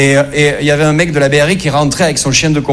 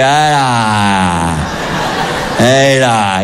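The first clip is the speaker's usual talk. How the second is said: long, low drawn-out dismay; a drawn-out groan sinking in pitch, imitating a fed-up husband; weary groan; 6 semitones lower, much slower than usual. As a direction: groan at the sight of your wife. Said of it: "il voit ma mère, il fait".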